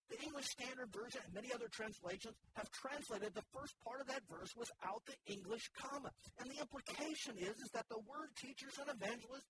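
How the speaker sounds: ordinary delivery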